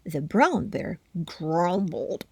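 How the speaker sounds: ordinary delivery, nothing marked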